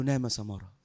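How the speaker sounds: low, unhurried reassurance; soothing sheikh. Acted. Sheikh telling drowsy Samara to sleep, low and soothing. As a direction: lull him with a low, soft voice.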